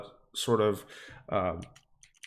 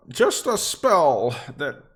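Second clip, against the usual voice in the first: woman offering reward; 10 semitones higher than usual. Acted. Cat's owner handing over a scroll case, hopeful. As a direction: offer it in a put-on high voice. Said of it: "she'll, she'll say".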